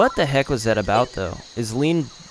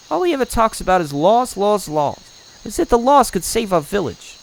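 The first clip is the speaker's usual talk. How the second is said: higher-pitched, fed-up complaint; exasperated villager; 9 semitones higher than usual. Acted. Villager complains about the elder's laws, exasperated. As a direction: scoff at the elder's rules.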